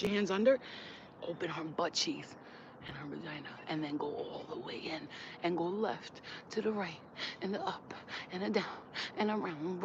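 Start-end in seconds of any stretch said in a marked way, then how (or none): 1.3-9.8 whisper. Shown as a demonstration. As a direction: lean in and breathe the words. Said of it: "drops to instruct intimately".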